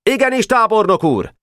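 put-on very high, eager boy-soldier call; high-pitched "yes sir" cry; military acknowledgement. A narrator's reading. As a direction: answer the general in a high shouted salute. Soldier boys answer their general.

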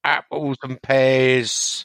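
slow, drawn-out mock Cockney; West Ham accent. Shown as a demonstration. Co-host showing off for a listener, hammed-up West Ham Cockney. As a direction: stretch it into broad East End Cockney. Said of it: "Do your West Ham accent".

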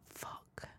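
hushed, breathy curse under the breath; whispered thought. Retold or imitated. Retelling her panic to the podcast, hushed whisper. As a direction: mutter it like a private panic.

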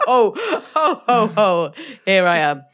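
ordinary talk

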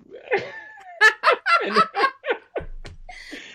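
long, high-pitched laugh; helpless high laughing fit; real laughter. Real, not acted. Guest tickled by host describing his look, laughing.

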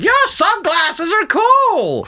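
put-on high, very slow old lady; mock granny complimenting. Old lady praises the sunglasses, high and slow. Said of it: "She was like".

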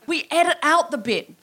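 ordinary delivery

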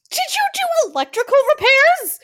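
put-on high, loud panicked kid yell; mock child scream. Kid bursting in on his mother, high panicked yell. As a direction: screech it like a frantic kid. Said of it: "your other child comes screaming".

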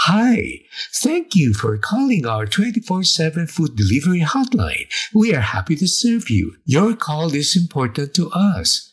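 chirpy automated hotline greeting; phone menu voice. Retold or imitated. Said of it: "the usual spiel is repeated".

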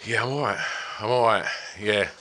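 ordinary delivery

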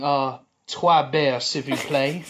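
slow, hammed-up French accent ordering beers; playing the tourist in a mock French accent.

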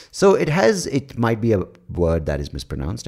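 ordinary delivery

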